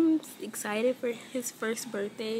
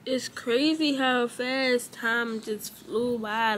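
ordinary talk

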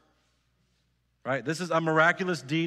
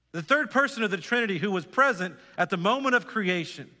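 rising preaching; 3 semitones higher than usual. Acted. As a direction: lift the church, build each line higher. Preaching builds to full force.

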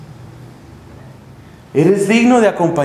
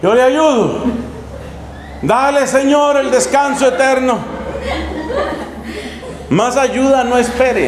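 higher, half-laughing mock blessing, slowing at the end; mock pious reply; 6 semitones higher than usual. Selfish friar mocks the drowning woman, ironic prayer. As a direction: bless her off with ironic sanctimony. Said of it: "este aquí con su tronco dice".

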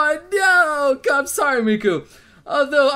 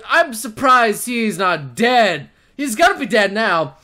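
usual speaking voice

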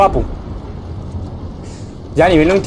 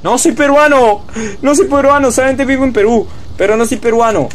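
indignant protest; 9 semitones higher than usual. Real, not acted. Indignant denial to chat.

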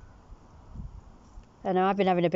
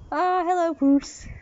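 much higher bright greeting; high hello to cat; 9 semitones higher, slower than usual. Real, not acted. Owner greets the cat up high. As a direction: sing hello to the kitty.

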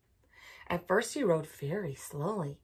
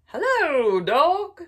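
cheerful duck greeting; 9 semitones higher, 12 dB louder, much slower than usual. Acted. Duck greets dog in story.

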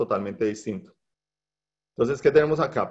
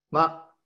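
MA said very quickly, without contour; a brief, toneless MA; neutral tone; 4 semitones higher than usual. Shown as a demonstration. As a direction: toss off a very short MA. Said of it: "que lo diríamos muy rápido".

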